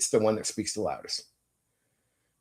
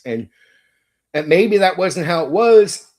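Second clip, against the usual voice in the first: ordinary delivery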